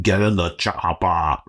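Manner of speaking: slow, lightly put-on Austrian action-hero accent; poor Arnold Schwarzenegger. Shown as a demonstration. Podcaster demos his weak Arnold, slow Austrian accent. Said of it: "a pretty poor Arnold Schwarzenegger".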